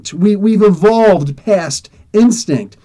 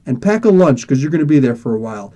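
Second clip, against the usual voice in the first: normal talking voice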